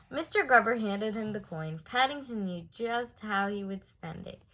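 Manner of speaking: ordinary delivery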